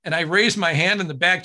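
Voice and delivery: ordinary talk, nothing marked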